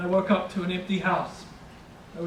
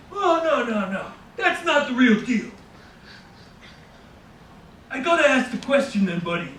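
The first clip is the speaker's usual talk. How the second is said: enthusiastic stranger; 6 semitones higher, 4 dB louder than usual. Retold or imitated. Bodega stranger praising the narrator's order, high and loud. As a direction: gush over the sandwich like an overeager local.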